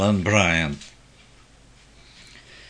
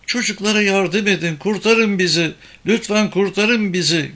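high-pitched woman's plea from under rubble; trapped woman pleading; 9 semitones higher than usual. A narrator's reading. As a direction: plead for rescue in a raised, higher voice. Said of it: "bir kadın sesi".

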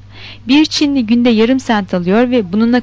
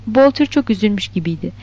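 plain reading voice

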